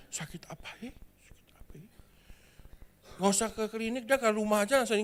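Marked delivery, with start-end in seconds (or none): 0.1-0.9 whispered worried question to himself. Acted. Jono alone after lunch, whispering to himself in worry. 1.3-1.9 barely audible whisper, fading away; fainter whisper. Acted. Jono repeats his worry to himself, fading to a faint whisper.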